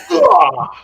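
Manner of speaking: high, loud pained "ugh"; hammed-up groan of being stabbed; mock stabbed groan. Rand gets stabbed in the stomach, exaggerated groan.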